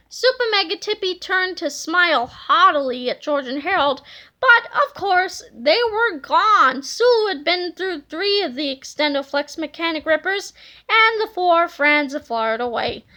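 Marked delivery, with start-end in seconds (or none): none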